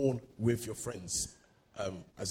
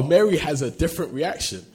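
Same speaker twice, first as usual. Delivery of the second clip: usual speaking voice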